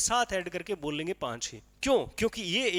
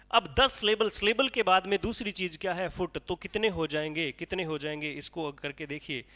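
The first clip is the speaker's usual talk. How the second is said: ordinary talk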